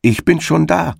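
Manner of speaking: hoch und triumphierend gerufen; triumphierender Zuruf. A narrator's reading. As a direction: dem Hasen von weitem entgegenrufen. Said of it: "rief ihm die Frau des Igels entgegen". (Igelfrau ruft dem Hasen triumphierend entgegen)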